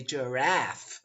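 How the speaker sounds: American "giraffe" with a flat a as in cat; drawn-out American "giraffe"; American pronunciation. Shown as a demonstration. Teacher showing how Americans say it, American accent. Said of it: "if you're American".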